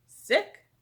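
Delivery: sharp, high, loud question; eager surprise. Acted. Doctor hears the principal is sick and pounces. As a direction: pounce on the word.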